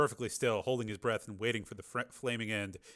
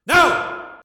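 loud, much higher angry shout; 15 dB louder than usual. A narrator's reading. Tommy turning on his brother, angry shout. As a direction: explode with refusal. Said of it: "turning on him angrily".

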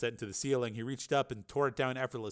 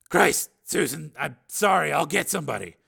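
louder, higher, urgent regret; agitated apology; 4 semitones higher, 7 dB louder than usual. Acted. Steele to injured Susan, agitated and sorry. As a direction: apologize in a rush of remorse.

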